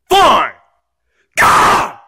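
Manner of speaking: put-on loud, high, huffy kid; screaming. Kid forced to apologize, screaming in a huff. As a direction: give in with an exasperated yell. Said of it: "if you don't stop screaming".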